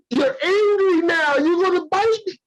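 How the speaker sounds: high, slow whiny mock voice. Voicing the stick-poker.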